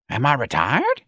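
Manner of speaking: high, rising hopeful question. A narrator's reading. Elderly employee hoping to be let go, hopeful. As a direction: ask it with eager hope, lifting at the end. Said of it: "Miss Trixie asked hopefully".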